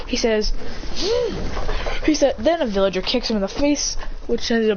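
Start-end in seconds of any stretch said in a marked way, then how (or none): 0.6-2.0 high, voiceless gasp; acted gasp. Storyteller plays his reaction to the fight, gasping. Character reacts after the slam.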